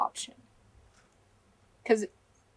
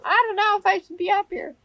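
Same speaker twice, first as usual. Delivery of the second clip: anxious whine; 10 semitones higher, 3 dB louder than usual. Retold or imitated. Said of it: "I'd still be sitting there going".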